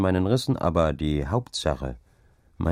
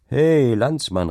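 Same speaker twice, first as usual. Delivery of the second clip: slow, louder, higher hail; calling out; 6 semitones higher, 6 dB louder, slower than usual. A narrator's reading. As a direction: hail a stranger along the road. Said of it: "rief ich". (laut anrufend)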